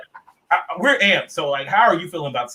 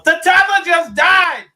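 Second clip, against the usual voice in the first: loud, high, drawn-out yell; retold shout; 14 semitones higher, 6 dB louder, much slower than usual. A stranger yelling the news across the bar, loud and stretched out. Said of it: "some lady at the end of the bar goes".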